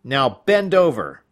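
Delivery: loud firm order; command. Acted. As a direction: bark it as a strict order. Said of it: "she commanded".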